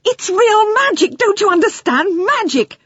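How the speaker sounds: high, loud, thrilled shouting; excited shouting. A narrator's reading. Boy shouting to the Indian about real magic, high and loud. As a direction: burst out with joy at the magic. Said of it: "he shouted".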